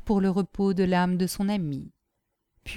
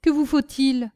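higher, raised, testy voice; growing impatience; 5 semitones higher, 4 dB louder than usual. A narrator's reading. Brigadier asking Nanon what she needs, growing impatient. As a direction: start to lose patience. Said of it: "avec un commencement d'impatience".

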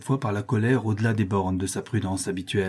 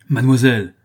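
louder, sharp rebuke of a single word; exclaiming; 7 dB louder than usual. A narrator's reading. Father rebuking his daughter, louder exclamation. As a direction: stop her in her tracks. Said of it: "s'écria mon père".

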